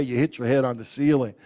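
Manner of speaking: normal talking voice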